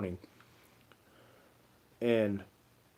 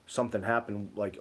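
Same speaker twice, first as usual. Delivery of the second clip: usual speaking voice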